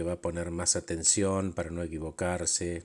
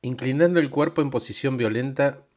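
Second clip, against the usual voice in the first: ordinary talk, nothing marked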